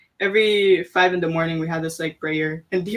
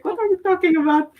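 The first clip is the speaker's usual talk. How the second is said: much higher, incredulous reenactment; confused question; 11 semitones higher than usual. Retold or imitated. Retelling her reply to the mentor, high incredulous confusion. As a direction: play your own confused self, pitched way up. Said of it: "I was like".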